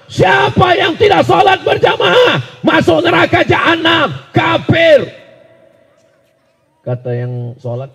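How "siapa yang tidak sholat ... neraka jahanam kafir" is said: loud, high-pitched fire-and-brimstone shout; scolding preacher. Acted. Ustadz on the pulpit condemning those who skip prayer, angry shouting. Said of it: "baru sekali dimarah-marahi pula".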